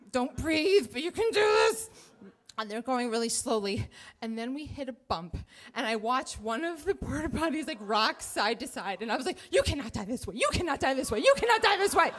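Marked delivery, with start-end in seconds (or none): 0.1-1.9 high, strained self-coaching; strained pep talk. Retold or imitated. Rider coaching herself beside porta potties, strained and high. 9.5-12.1 frantic mantra, climbing higher and louder; mounting panic. Retold or imitated. Rider watching a porta potty rock, increasingly panicked.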